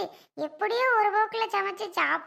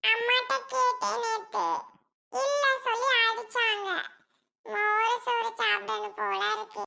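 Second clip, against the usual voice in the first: put-on young girl voice; 5 semitones higher than usual. Girl asks aunt for food.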